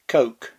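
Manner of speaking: Coke, one syllable with a long o and hard k; accepted American pronunciation. Shown as a demonstration. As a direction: Coke with a plain k ending. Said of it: "Apparently, it's".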